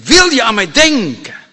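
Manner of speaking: loud, high appeal; impassioned plea. Acted. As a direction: plead it out at full voice. Criminal pleads with the king.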